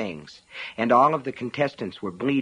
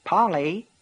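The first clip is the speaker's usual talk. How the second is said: calling up the stairs, concerned; worried voice calling a name; 7 semitones higher, 3 dB louder than usual. A narrator's reading. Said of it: "Nettie's voice was concerned".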